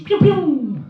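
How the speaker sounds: high zapping pew pew; imitated price gun zap. Comic mimics supermarket price gun, zapping sound. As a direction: make the price gun's laser sound.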